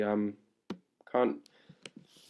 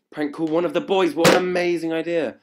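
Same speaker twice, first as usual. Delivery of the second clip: higher and louder with glee; excited; 8 semitones higher, 9 dB louder than usual. Real, not acted. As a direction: shout the idea with delight. Excited about prank idea.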